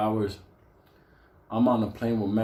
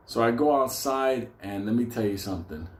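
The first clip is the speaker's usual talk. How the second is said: ordinary talk, nothing marked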